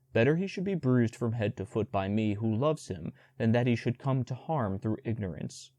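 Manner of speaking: usual speaking voice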